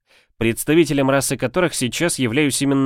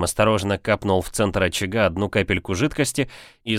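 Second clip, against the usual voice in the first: plain reading voice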